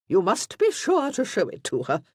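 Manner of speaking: high, loud, imperious order; commanding marchioness. A narrator's reading. Marchioness tells the Duke to show the path, commanding. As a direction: insist like a lady used to obedience. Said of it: "in a voice that was clearly accustomed to command".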